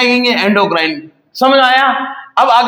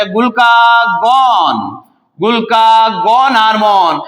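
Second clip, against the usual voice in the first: slow stress; much slower than usual. Shown as a demonstration. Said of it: "teacher stresses new term slowly".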